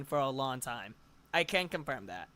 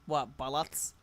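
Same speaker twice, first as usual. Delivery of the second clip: American accent; 5 semitones lower, 6 dB quieter, slower than usual. Real, not acted. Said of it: "Never ever say anything British in an American accent".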